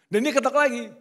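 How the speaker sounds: delighted laughing retelling. Real, not acted. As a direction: laugh through it, loud and high. Preacher laughs at the twist.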